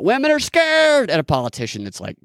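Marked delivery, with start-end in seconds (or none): 0.0-1.1 high, drawn-out mock holler; mock shouting. Host mimics shouting at a distant politician, mock shouting. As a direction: imitate someone hollering at a far-off politician. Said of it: "Just shouting out".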